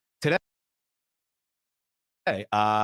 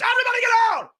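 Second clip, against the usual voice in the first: loud high yell ordering everyone out; yelling gunman; 6 dB louder, much faster than usual. Retold or imitated. Friend storming the hospital, yelling. Friend busting him out with gun.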